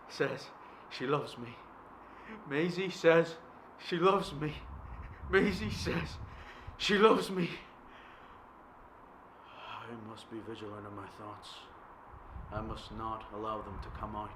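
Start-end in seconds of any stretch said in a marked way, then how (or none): 2.5-7.5 louder and louder, higher; building mantra. Acted. 9.5-14.3 soft tense self-command; hushed. Acted. Retreat to hushed self-control.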